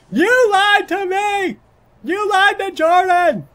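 over-the-top wounded shout; voiced prisoner yelling. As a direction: yell it like a jilted inmate, dragging each word. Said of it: "stop yelling at me".

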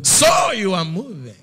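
slow, loud and high, weighty as thunder; God's voice booming down from above. Retold or imitated. God confronting the preacher in the car, slow and booming. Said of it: "because the way he speaks".

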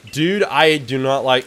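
ordinary delivery

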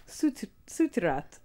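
Sutirat said slowly, syllable by syllable; careful slow try at Sutirat; tentative Thai pronunciation of Sutirat; Thai name attempt. Shown as a demonstration. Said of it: "Elma's asking me to pronounce it".

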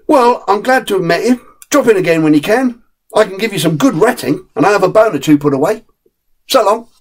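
a mate saying so long; friendly terrier Jack. Acted.

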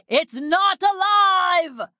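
high, drawn-out theatrical cry; dramatic cry. Acted. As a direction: cry it out slowly like a mad scientist. Mad scientist's dramatic cry.

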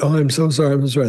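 höher, lautes übertriebenes Entschuldigen; apologetic gush. Retold or imitated. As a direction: spiel die erschrockene Frau, die sich hastig entschuldigt. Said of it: "And the woman said".

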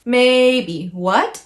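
MAYBE held high and level, WHAT rising; level MAYBE, then upswept WHAT; first then second tone. Shown as a demonstration. Teacher pairing English words with tones, level then rising. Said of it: "First and second".

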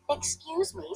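a lightly voiced tiny sparrow; small sparrow. A narrator's reading. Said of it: "said the sparrow".

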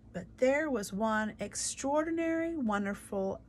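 usual speaking voice

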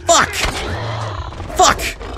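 very high, very loud outraged shouting; frantic, accusing screams; panicked yelling. Real, not acted. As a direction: yell in disbelief at your partner. Panicking, scolding Joel for yelling.

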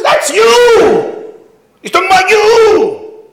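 very high, loud jeering voice; mocking shout. Rebbe jeering at the boy in front of classmates, shouting. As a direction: shout it to humiliate him.

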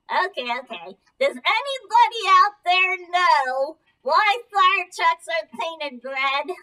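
normal talking voice

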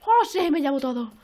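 loud burst of glee; gleeful, loud opening yell; excited looting. Real, not acted. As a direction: shout it as you grab everything. Grabbing loot in the game.

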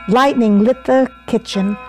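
ordinary delivery

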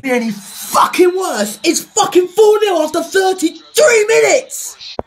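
high, loud, drawn-out outburst; furious shout. Real, not acted. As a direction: yell in outrage at the score. Furious at the score mid-game.

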